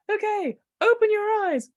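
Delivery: ordinary talk, nothing marked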